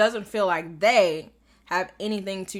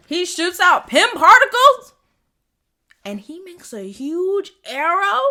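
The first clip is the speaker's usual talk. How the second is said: higher, louder, slow gushing; thrilled disbelief; 10 semitones higher, 13 dB louder, slower than usual. Real, not acted. Fan gushing to viewers about the giant arrow, thrilled. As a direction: savor every word in awe. Geeking out over Pym arrow.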